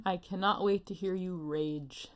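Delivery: normal talking voice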